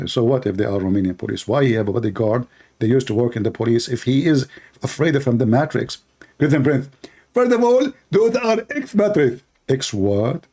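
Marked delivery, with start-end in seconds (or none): 6.4-9.4 high, petulant caricature; lampooned rival refusing to leave; mock debater. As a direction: whine the threat higher.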